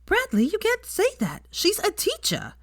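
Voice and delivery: put-on high, prim young girl telling off a classmate; a bossy kid correcting Bradley; scolding schoolgirl. Girl scolding Bradley for insulting the teacher, high and prim.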